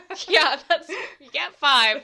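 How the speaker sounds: high, loud, laughing words; real laughing. Real, not acted. Teased by co-host over obvious word, laughing. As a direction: talk through a burst of laughter.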